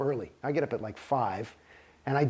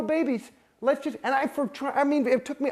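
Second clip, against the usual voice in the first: ordinary talk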